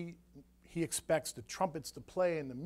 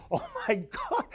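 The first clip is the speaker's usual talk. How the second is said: high, amazed oh my God; slower than usual. Retold or imitated. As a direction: gasp it out in wonder. Amazed inner thought.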